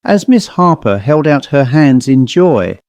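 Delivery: usual speaking voice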